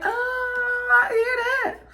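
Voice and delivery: very high, drawn-out holler; high, long-held exclamation of recognition; soulful outcry. Acted. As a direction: cry out as if moved by the music. Acting out hearing mama in music.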